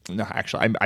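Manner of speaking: ordinary talk, nothing marked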